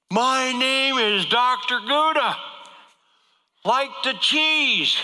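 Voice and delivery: loud, high and slow into the ear; shouting slowly as if to a deaf patient; mock doctor yell. Doctor yelling his name into a patient's ear. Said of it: "As loud as he's screaming in my ear".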